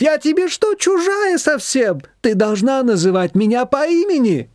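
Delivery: be wounded at being treated as a stranger; indignant. A narrator's reading. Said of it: "возмутилась свекровь".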